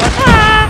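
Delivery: loud, high "ah" yell; sudden high outburst; excited yell. Real, not acted. Sudden outcry during the match.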